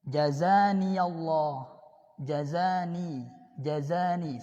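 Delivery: "jazani" drawn out slowly and evenly; slow teaching model. Shown as a demonstration. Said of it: "Atau ini misalnya". (ucapkan "jazani" pelan-pelan)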